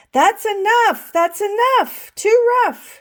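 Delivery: high, loud scolding teacher; sharp teacher's shout of "That's enough!". A narrator's reading. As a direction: stop the class cold with a shout. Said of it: "shouted Missus Ruler".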